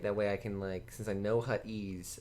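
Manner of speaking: ordinary talk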